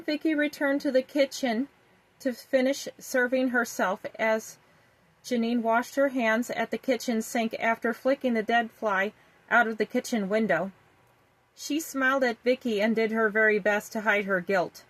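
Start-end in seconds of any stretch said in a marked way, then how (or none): none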